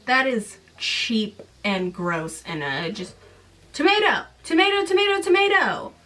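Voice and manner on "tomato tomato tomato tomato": high bouncing singsong, loud; mock singsong. Mocking the book's line in a high singsong. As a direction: bounce it like a silly chant.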